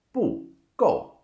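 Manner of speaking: 不够 said with bu high and falling; 不够 with two fourth tones, the wrong way. Shown as a demonstration. Teacher showing the wrong 不够 pronunciation. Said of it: "Don't say it like that".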